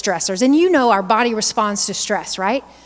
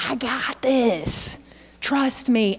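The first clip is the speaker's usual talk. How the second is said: slow, softer, unbothered reassurance; calm teacher; 5 dB quieter, much slower than usual. Retold or imitated. Presenter voicing a kindergarten teacher to worried parents, slow and calm. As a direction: settle the room, take your time.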